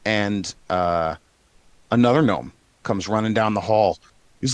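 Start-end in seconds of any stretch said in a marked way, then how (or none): none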